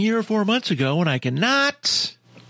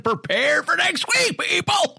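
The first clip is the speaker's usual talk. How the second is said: shout; 11 semitones higher than usual. Real, not acted. Toasting audience, shouting.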